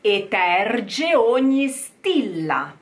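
Eterge ogni stilla slowly and clearly; slow model phrase. Shown as a demonstration. Coach modelling the line, slow. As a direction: lay out the phrase for copying. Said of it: "gna, gna".

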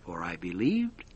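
usual speaking voice

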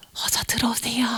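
very soft, low invitation; hushed welcome. Acted. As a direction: usher them in secretly, barely voiced. 라합 secretly lets spies in. (소리 죽여 맞이하듯)

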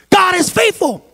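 loud, high preaching shout; full-force preaching. Acted. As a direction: preach it to the back row.

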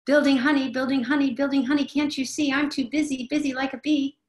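hammed-up harried adult, buzzing busy. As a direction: brush the child off in a fluster. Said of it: "the inner adult ... says".

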